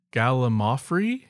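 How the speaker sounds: normal talking voice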